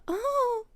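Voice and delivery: high squeaky oh of shock; a sudden high-pitched gasp-word; startled oh. Retold or imitated. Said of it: "Not nervous in".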